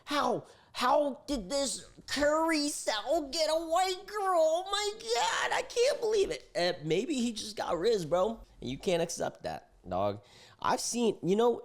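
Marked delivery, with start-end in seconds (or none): 0.0-6.5 high, slow, put-on gasping voice; mocking shocked onlookers. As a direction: squeal it like a scandalised gossip. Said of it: "They're gonna be like".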